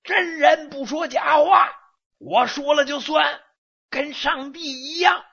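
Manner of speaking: loud boastful high shout. Acted. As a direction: bellow it out proudly. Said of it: "拉开嗓门也喊".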